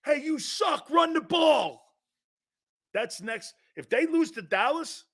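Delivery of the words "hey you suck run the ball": mock fan shout. Fans with signs shouting.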